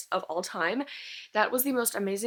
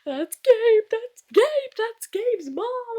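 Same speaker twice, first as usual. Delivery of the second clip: much higher and louder, squealing the name; excited squeal; 14 semitones higher, 8 dB louder, much slower than usual. Retold or imitated. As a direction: shriek it like a fan who just cracked the twist. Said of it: "Because I was like".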